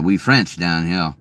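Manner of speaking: ordinary talk, nothing marked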